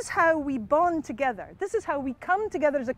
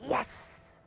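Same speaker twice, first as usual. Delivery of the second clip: whispered, gloating "yes"; husband's secret triumph; 11 dB quieter than usual. Acted. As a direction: hiss it softly to yourself in triumph. Husband's private triumph at hit.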